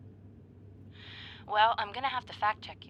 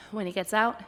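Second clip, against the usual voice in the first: normal talking voice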